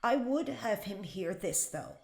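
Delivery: normal talking voice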